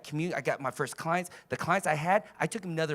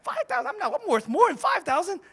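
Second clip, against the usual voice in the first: indignant self-worth; 11 semitones higher, 4 dB louder than usual. Retold or imitated. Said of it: "I was like".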